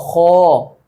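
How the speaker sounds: ordinary talk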